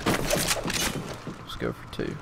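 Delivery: slow, low whisper to himself; whispered aside. Real, not acted. Gamer whispering while setting up a kill, quiet aside. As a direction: hush it like a sneak.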